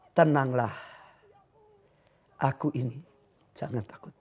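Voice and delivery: soft, low and hushed, fading to a whisper; calm reassurance. Acted. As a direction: soothe the frightened ones gently. Jesus calms frightened disciples. (suara lembut menenangkan)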